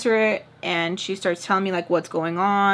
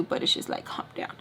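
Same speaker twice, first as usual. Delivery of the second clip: barely voiced, held-in calm down; whispered to herself; 9 dB quieter than usual. Narrating the fight with her partner, an unvoiced muttered aside. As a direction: whisper it under your breath, bottling it up. Inner thought, holding back irritation.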